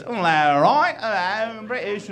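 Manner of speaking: high, slurred mock British drone; sing-song cartoon Brit babble; British gibberish. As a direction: reduce the British accent to vowels. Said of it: "All I ever hear is".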